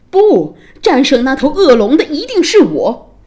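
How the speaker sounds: high, loud defiant declaration; bursting-out hero. A narrator's reading. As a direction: rush in and claim the victory. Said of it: "Simon冲了出来说".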